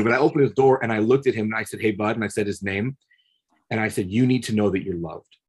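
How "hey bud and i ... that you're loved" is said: gentle reassurance. Retold or imitated. To the sobbing inmate through the door, soft and tender. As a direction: quietly tell a weeping young man he is loved. Speaking to his attacker, gently.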